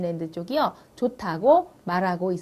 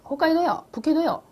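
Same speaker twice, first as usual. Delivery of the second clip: surprised question; 8 semitones higher, 3 dB louder than usual. Retold or imitated. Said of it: "노상이 그랬어요".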